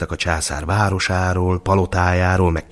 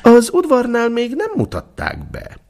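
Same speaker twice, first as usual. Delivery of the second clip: high, fussy court official voice; head steward. A narrator's reading. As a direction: play the nervous steward, pitched up. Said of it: "rázta fejét a főudvarmester".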